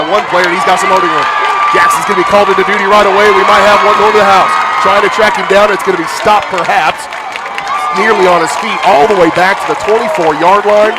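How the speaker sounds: excited play-by-play. Real, not acted. Live kickoff return call.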